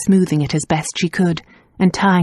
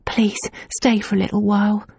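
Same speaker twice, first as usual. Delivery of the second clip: pleading whisper; 3 semitones higher, 4 dB quieter than usual. A narrator's reading. Nan pleading.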